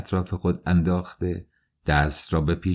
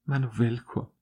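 softer, higher moaned plea; moaning plea; 4 semitones higher, 6 dB quieter than usual. Acted. Dunya, held in his arms, moans to be let go. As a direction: let it out as a weak moan. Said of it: "دنیا نالید".

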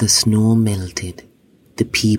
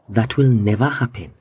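plain reading voice

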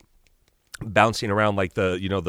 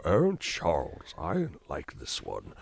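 put-on slow, soft older British villain; Magneto; 3 semitones lower, 5 dB quieter, slower than usual. Shown as a demonstration. British actor's villain talks to his friend, slow and soft. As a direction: savour it slowly, aged British thespian. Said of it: "or Magneto".

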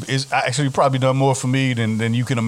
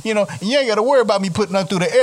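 high, fast [name] impression; 9 semitones higher, faster than usual. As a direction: quote him in his drawling swagger. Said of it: "to quote [name] one more time".

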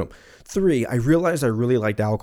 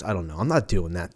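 ordinary talk

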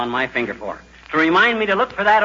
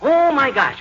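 sudden realization; 10 semitones higher, 3 dB louder, slower than usual. Acted. McGee suddenly remembers something.

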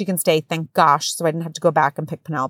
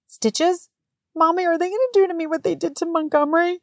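lightly voiced little boy, pitched higher; worried little boy voice, a touch higher; imitating son; 10 semitones higher than usual. Son asking mom about stitches, small worried little-boy voice.

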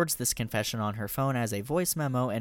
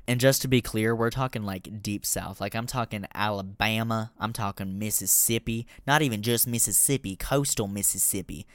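ordinary talk, nothing marked

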